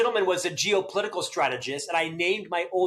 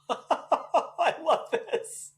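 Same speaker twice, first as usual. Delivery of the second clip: laughs, then says it slowly and delighted; a slow, delighted laugh into the words; 12 semitones higher, much slower than usual. Real, not acted.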